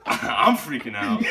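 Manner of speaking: hammed-up freak-out, high and stretched; mock panic. Drunk man after calling his parents, mock panic.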